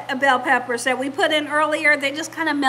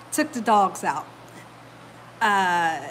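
ordinary delivery, nothing marked

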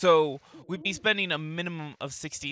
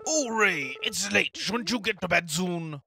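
mayor voice; 2 semitones higher, 5 dB louder, slower than usual. Acted. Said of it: "Every time I do the mayor's voice".

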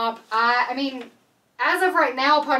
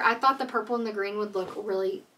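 ordinary talk, nothing marked

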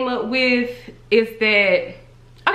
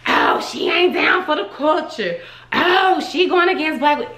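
higher, sing-song imitation of critics; mocking critics; 6 semitones higher than usual. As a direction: parrot the accusers, mocking.

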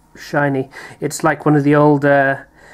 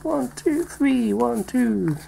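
much higher, softer playful counting; sing-song count; 9 semitones higher, 5 dB quieter, much slower than usual. Pretend. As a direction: chant the count like a little ritual. Counting off the pack cut.

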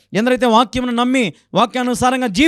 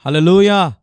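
called-out hallelujah; 3 semitones lower, 5 dB louder than usual. Real, not acted. Preacher calls out to congregation.

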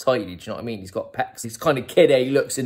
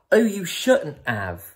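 posh woman's voice; 7 semitones higher, slower than usual. Retold or imitated. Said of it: "he's like".

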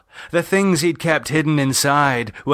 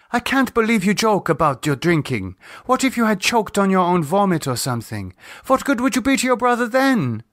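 higher, rising upset reproach; exasperated scolding; 10 semitones higher than usual. Acted. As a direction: tell him off, worried. Lisa scolds his drinking.

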